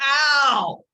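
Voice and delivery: loud, high howl of pain; imitated scream. Lecturer acts the learner behind the wall, loud pained scream. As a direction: cry out as if zapped hard. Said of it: "you just hear them screaming".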